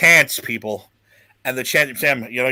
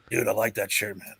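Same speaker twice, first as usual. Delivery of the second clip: soft, hushed whisper, lips barely moving; quiet secretive whisper through a still mouth; 6 semitones lower, 9 dB quieter than usual. Retold or imitated. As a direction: whisper it so nobody sees you talk. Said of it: "He's whispering and, like, not trying to move his mouth".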